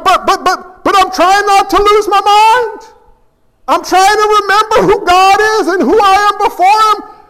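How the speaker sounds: high-pitched, stammering panic; David freaking out. Acted. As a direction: babble in terror, fighting to keep your head. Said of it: "why are you freaking out".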